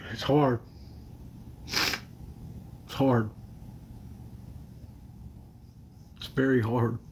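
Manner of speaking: real crying, halting with long sniffly pauses; fighting back tears between short phrases; tearful. Real, not acted. Streamer overcome on live, tearful. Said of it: "All right, stop crying".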